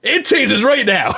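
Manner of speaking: high, loud, slow mock yell; hammed-up ultimatum. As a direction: lay down the law, half laughing. Acted ultimatum in kitchen story.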